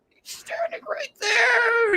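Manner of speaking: slow, very high whisper-scream; whispered squeal. Retold or imitated. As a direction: freak out under your breath, like a fangirl. Voicing friend beside the actor.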